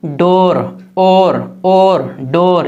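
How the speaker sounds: "डोर" with a long, round o, not oo; long o sound. Shown as a demonstration. Teacher showing the right reading of door, long o in "डोर". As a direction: hold the o in "डोर" and "और". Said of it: "दूर नहीं पढ़ते हैं".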